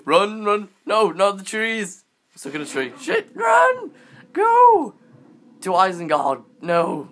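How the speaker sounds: high, loud panicked screaming; being chased. Real, not acted. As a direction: scream while running for your life. Fleeing a tiger in game.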